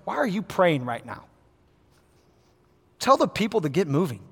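God's hushed, firm rebuke to Moses; quiet divine rebuke. Retold or imitated. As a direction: calmly tell Moses to get moving.

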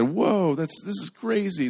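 high-pitched astonished exclamation; big drawn-in wow, voice up high; amazed. Acted. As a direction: react in acted amazement at the catch. Acted fishermen amazed by huge catch.

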